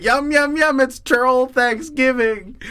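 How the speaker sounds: high, hammed-up troll voice; gleeful troll. Second troll eating a turkey leg, hammed-up glee. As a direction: relish it like a feasting storybook troll. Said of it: "And it said".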